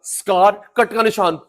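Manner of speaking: ordinary delivery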